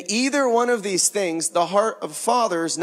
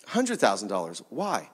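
lower, softer, taken aback; low stunned echo of "hundred thousand"; disbelieving echo; 9 semitones lower, 6 dB quieter than usual. Retold or imitated. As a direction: repeat "a hundred thousand dollars" as if stunned by the sum. Father echoes request in disbelief.

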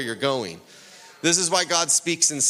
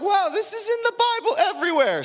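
much higher, fast, wide-eyed wow; mock amazement; faster than usual. Preacher mimicking a reader finding signs everywhere, hammed-up awe. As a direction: gush like you just discovered it. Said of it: "You're like".

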